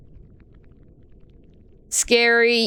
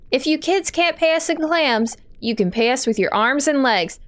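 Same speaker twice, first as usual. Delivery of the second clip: ordinary talk, nothing marked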